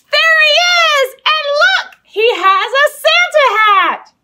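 very high, loud, slow delighted squeal; thrilled to find the mouse; excited discovery. Real, not acted. Mouse found in the game.

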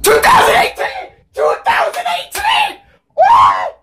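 very high-pitched shrieked cheer; ecstatic screaming. Real, not acted. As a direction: scream it out, losing control with joy. Release year revealed in trailer.

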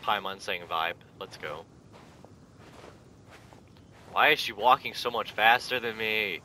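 ordinary talk

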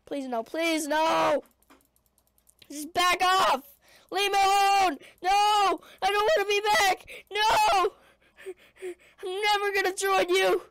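loud desperate pleading; anguished denial. Pretend. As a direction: beg them to back off. Pleading with attackers.

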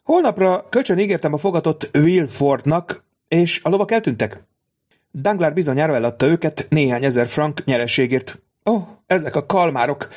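a hint of a scornful noblewoman; haughty baroness. Baroness voiced in story.

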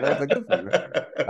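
high laughing question. Real, not acted. Writer laughing back at the fan, laughing. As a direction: let the laugh carry the words.

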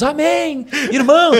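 very high, loud mock church cries; hammed-up revival-meeting yell; imitated congregation shouts. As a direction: holler it like a fervent worshipper. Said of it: "O pessoal começou a gritar".

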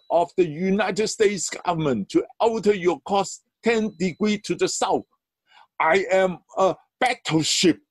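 high, furious commanding yell; angry. Acted. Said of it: "the captain growled back over the radio".

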